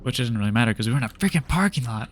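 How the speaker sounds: ordinary delivery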